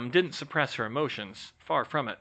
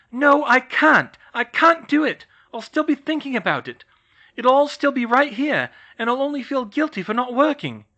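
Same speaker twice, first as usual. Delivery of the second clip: desperate insistence; 13 semitones higher, 10 dB louder than usual. Acted. Hermione insisting to George she cannot stop, loud. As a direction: plead your case at full voice.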